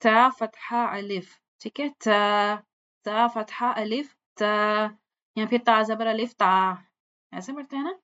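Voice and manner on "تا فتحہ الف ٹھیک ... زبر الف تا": تا said light and thin, slow; thin letter sound. Shown as a demonstration. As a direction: voice تا thin and effortless. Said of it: "باریک جو حروف ہوتے ہیں".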